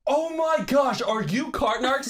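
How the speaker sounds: retold excited gush to a favorite YouTuber; starstruck gush. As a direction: fangirl meeting her hero. Retold meeting her internet hero.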